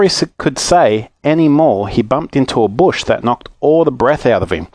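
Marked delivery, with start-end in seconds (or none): none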